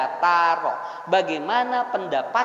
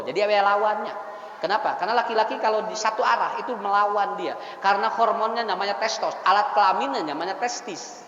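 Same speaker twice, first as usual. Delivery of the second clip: normal talking voice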